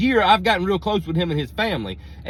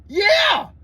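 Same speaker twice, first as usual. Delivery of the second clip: high, loud exasperated yell; desperate shouted answer; shouted yeah; 13 semitones higher, 5 dB louder than usual. Retold or imitated. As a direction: holler it across the field. Shouting confirmation across pasture.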